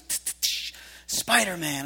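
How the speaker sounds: whispered tch clicks then the name; clicking web sounds, lightly voiced boy; web-shooting noise. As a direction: mimic web shooters with the tongue. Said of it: "he goes".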